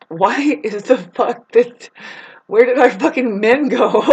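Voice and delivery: high, loud, laughing cry of confusion; mock bewildered sheep. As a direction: squeal it like a lost ewe, half laughing. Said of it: "The sheep are like".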